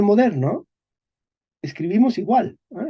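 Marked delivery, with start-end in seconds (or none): none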